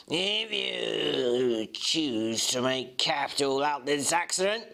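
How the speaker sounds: a lightly voiced cool, sneering villain, slow; slow and cold, sneering at the crowd; hint of a sneering Mr Hyde, unhurried; sneering gentleman. A narrator's reading. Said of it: "sneering coolness".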